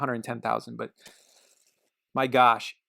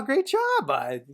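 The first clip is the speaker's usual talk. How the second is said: sudden high cheer for his son; praising bud; 12 semitones higher, 4 dB louder, much slower than usual. Real, not acted. Dad cheering his little son's effort, high and bright. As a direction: burst of real praise, then back down.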